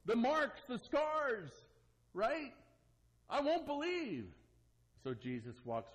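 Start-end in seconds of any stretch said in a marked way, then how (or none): 0.0-4.2 higher, demanding doubter; a lightly voiced upset Thomas; griping Thomas. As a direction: insist you need proof first. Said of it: "Thomas is upset. He's scared. He's griping".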